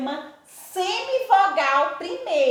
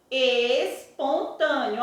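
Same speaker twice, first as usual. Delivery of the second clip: espontâneo drawn out slowly, syllable by syllable; slow syllable demo; much slower than usual. Shown as a demonstration. Teacher slowly spelling out espontâneo for syllable counting. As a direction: stretch espontâneo slowly so they can count. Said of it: "eu sempre conto última, penúltima".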